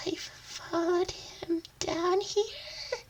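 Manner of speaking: soft high slow voice; timid high whisper. Acted. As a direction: answer small and scared. Hero answers timidly.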